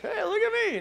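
high, squeaky look-at-me voice; comic high colt voice; show-off little colt. Acted. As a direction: play a tiny animal delighted by attention. Voices the proud, oblivious colt.

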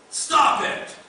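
sudden loud, high shout; acted outburst of stern correction; shouted rebuke. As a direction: shout it as a sharp scolding command. Repeats the imagined harsh rebuke.

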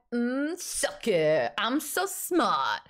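drawn-out, slow smug taunt; a slow teasing gloat at a friend. Shown as a demonstration. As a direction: rub it in slowly and proudly. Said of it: "That's what I mean when I say gloat".

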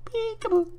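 high mocking peekaboo; taunting singsong. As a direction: tease the enemy playfully. Taunting enemy.

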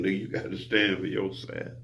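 normal talking voice

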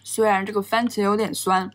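speaking between bites; talking while chewing. Real, not acted. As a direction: mouth partly full of food. Seen 1: Bites burger before; pursed, chewing-like lips while speaking early on; no pineapple.

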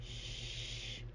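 soft breathy shush, barely voiced; whispered shush. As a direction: hush the listener gently. Hushing refrain.

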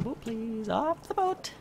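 high, whiny plea; high whine. Real, not acted.

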